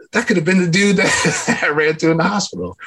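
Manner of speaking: high, laughing disbelief. Real, not acted. Speaker tells the audience about Gabriel, laughing in disbelief. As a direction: laugh through the words at the thought.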